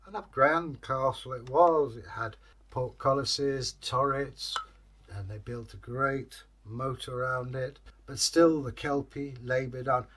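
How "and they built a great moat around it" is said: usual speaking voice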